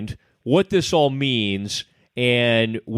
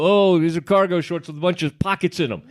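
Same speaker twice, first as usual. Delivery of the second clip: mock customer; 6 semitones higher than usual. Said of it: "these are not like cargo".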